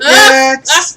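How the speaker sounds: high, bright service-desk call; chirpy clerk calling next; customer service voice. Pretend. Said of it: "my customer service voice".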